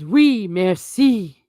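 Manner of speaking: slow, hammed-up French accent; mock French. Mocking a French commenter in a slow mock French accent. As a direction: drawl it like a pompous Frenchman. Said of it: "Lord Poyle says".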